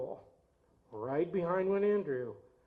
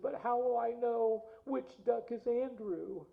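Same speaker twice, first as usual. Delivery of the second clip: put-on higher, slower, small child's worried plea; crying little boy; 6 semitones higher, 3 dB quieter, slower than usual. Little Bud asking his dad, a mock tearful child voice. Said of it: "He started crying".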